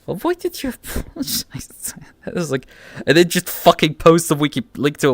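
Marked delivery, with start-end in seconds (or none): 0.1-1.9 high-pitched quizzical mock question; imitating a baffled voice, pitched up high; mock high squeak. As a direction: squeak it up in disbelief. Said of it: "And like".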